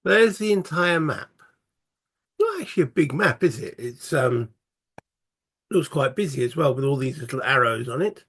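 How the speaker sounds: usual speaking voice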